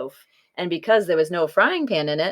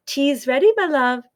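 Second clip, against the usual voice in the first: loving wife; 7 semitones higher than usual. A narrator's reading. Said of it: "said Oonagh".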